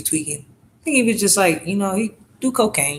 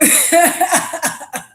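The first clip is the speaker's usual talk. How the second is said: high burst of laughter; 12 semitones higher than usual. Real, not acted. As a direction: crack up at the memory. Laughs after retelling.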